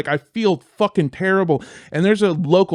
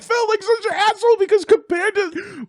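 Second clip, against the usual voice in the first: very high, squealing lament; embarrassed wail; 12 semitones higher than usual. Retold or imitated. Comic realizing how his joke looked, mortified.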